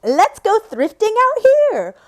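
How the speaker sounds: high, loud, drawn-out mock enthusiasm; hammed-up gleeful idea to yourself; excited impulse. Waking up and talking herself into thrifting, excited.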